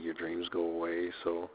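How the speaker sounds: ordinary talk, nothing marked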